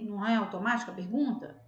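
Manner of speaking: normal talking voice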